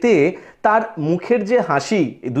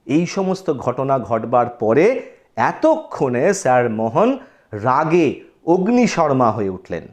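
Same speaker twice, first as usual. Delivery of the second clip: normal talking voice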